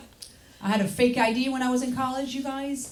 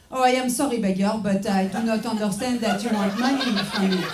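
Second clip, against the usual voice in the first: stiff foreign English; 3 dB louder than usual. Pretend. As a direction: pretend not to understand in careful foreign English. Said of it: "you can just go".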